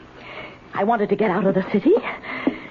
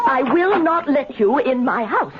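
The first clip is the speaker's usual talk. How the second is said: scolding the cat; 11 semitones higher than usual. Acted. Old woman scolding unseen cat.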